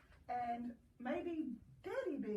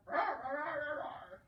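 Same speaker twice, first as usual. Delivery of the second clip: loud growly rawrs; bear talk; 4 semitones higher, 6 dB louder than usual. Acted. A bear roaring its complaint over the porridge, loud and hammy. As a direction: roar it like a bear. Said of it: "he said it in bear talk".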